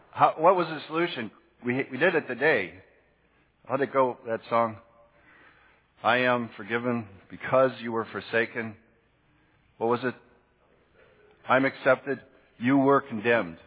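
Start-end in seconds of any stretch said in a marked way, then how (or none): none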